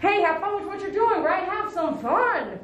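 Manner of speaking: high, playful encouragement. Real, not acted. Playful encouragement after the joke.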